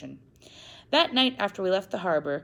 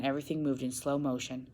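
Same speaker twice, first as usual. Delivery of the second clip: usual speaking voice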